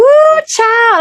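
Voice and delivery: high, loud Southern mom exclamation; imitating mom's sudden Southern twang; mom's Southern accent. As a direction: belt it like a Southern auntie on the phone. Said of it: "and suddenly it's just there".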